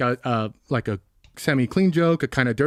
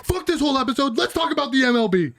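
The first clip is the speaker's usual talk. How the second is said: mock outburst; 10 semitones higher than usual. Real, not acted. Mock outburst, much higher.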